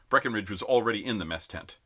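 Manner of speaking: ordinary delivery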